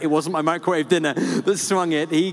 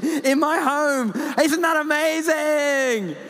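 fanboying; 9 semitones higher than usual. Retold or imitated. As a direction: gush at him like a starstruck fan. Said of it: "I start fanboying him".

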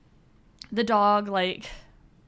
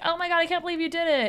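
quick, higher imitation of an amazed buddy; mock amazement; 8 semitones higher, much faster than usual. Friend reacting to Ryan's won bet, mock amazement. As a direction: act out a friend's disbelief in a rush.

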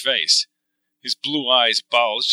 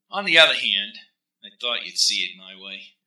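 smug; 3 semitones lower, 3 dB quieter than usual. Acted. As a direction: gloat quietly. Rennie smug.

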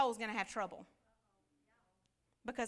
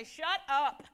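put-on a sharp, louder shut up at the kids in the back; snapped shut up; 2 semitones higher, 6 dB louder than usual. Parent on a car trip snapping at noisy kids, louder. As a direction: snap it at the back seat. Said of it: "you want to turn around and say".

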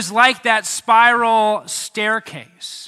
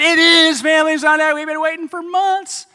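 excited reply; 8 semitones higher, 6 dB louder than usual. Retold or imitated. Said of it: "I said".

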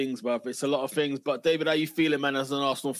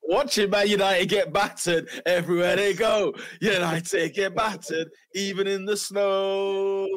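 gloating; 5 semitones higher, 4 dB louder, slower than usual. Real, not acted. Gloating highlight.

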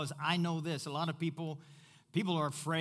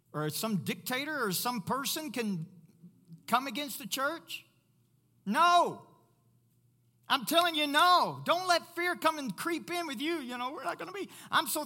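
emphatic preaching; 11 semitones higher, 5 dB louder than usual. Real, not acted.

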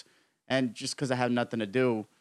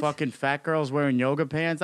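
ordinary talk, nothing marked

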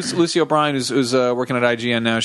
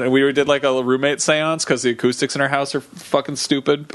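ordinary talk

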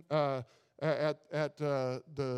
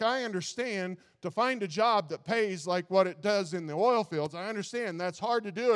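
ordinary delivery

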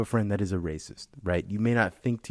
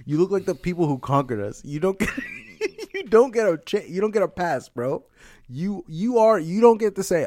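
laughing; 7 semitones higher than usual. Real, not acted. Teasing his light-skinned friend, laughing. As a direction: crack up while teasing him.

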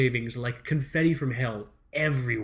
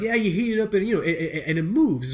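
louder, higher-pitched clerk impression; hardware store guy; 6 semitones higher, 4 dB louder than usual. Store guy explaining the fix to a customer, emphatic. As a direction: imitate an eager clerk, pitched up and loud.

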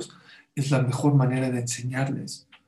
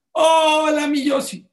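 much higher and louder, singsong hello to a little son; bright teasing daddy greeting, voice jumping way up; playful sweet greeting; 13 semitones higher, 8 dB louder, slower than usual. Retold or imitated. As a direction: greet him like a delighted dad teasing his kid. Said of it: "A cada hijo le habló con otro tono".

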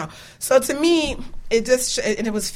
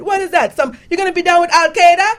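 alarmed mock-Jamaican mother, pitched way up; Jamaican accent; 9 semitones higher, 9 dB louder than usual. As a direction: imitate a mother's high, loud Jamaican accent. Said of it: "She's Jamaican, in case you wanna know what accent I'm doing".

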